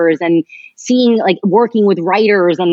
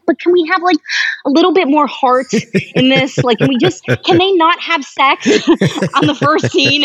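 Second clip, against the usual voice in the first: much higher, pleading, half laughing; sensitive pleading; 9 semitones higher than usual. Retold or imitated. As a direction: beg gently for more heart in the story. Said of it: "so sensitive".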